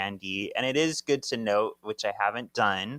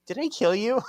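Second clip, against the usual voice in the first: Did I kill you squeaked high in mockery; mocking squeak; 12 semitones higher, 4 dB louder than usual. Paige mocking Prue's question, high mocking squeak. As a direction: parody the question, high and silly. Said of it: "You know you're evil when".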